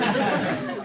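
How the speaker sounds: loud breathy laughter. Real, not acted. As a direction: let the laugh burst out. Laughs retelling the scene.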